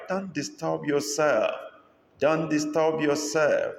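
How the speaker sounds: high, slow polite refusal; lightly voiced buyer. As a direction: gently wave off the help. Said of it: "what is the response of the buyer?".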